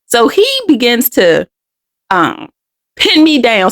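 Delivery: ordinary delivery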